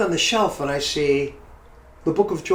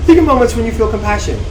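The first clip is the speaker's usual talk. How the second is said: louder and faster, pushing it; louder emphatic retake; 5 semitones higher, 8 dB louder, faster than usual. Shown as a demonstration. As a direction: say it again with more force. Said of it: "Another train".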